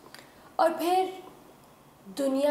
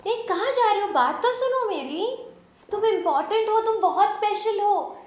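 high, louder, urgent plea; pleading call; 9 semitones higher, 4 dB louder than usual. Acted. Companion begs the leaving heroine to listen, urgent and high. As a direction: call after her, pitch raised throughout.

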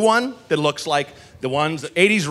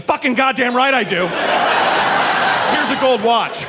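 giddy excited gush; 8 semitones higher than usual. Retold or imitated. To the ice cream guy across the street: high laughing gush. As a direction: gush with glee, giving everything away.

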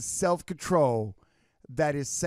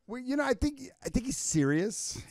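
nervous staff; 7 semitones higher, 4 dB louder than usual. Retold or imitated. Staff whispering about serious boss.

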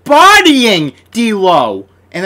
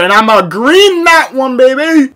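higher and louder, cocky; hyped boast; 8 semitones higher, 7 dB louder than usual. Real, not acted. Gamer boasting about his shot, a loud hyped cheer. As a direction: gloat it out.

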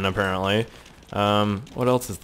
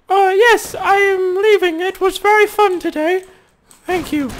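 a shrill puppet voice for the stranger; squeaky put-on voice; 5 dB louder than usual. Speaking through the stranger as a very high silly voice. Talking through another character.

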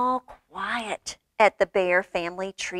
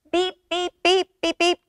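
high electronic beeping; video game beeps; 7 semitones higher than usual. A narrator's reading. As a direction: imitate a game's short high beeps. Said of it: "goes the video game".

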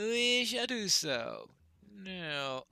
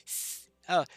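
usual speaking voice

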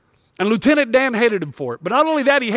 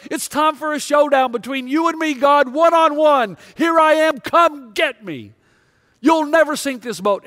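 defiant yell at God; 6 semitones higher than usual. Retold or imitated. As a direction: rage at the sky like a man on a mast. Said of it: "And he yells".

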